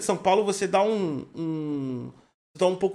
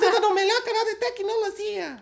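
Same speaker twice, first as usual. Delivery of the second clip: high put-on Chinese-style announcer voice; mock Chinese accent presenting the tech channel; 13 semitones higher than usual. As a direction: keep the fake accent going. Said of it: "Não é assim que os chineses falam".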